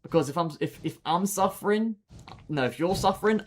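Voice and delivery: ordinary delivery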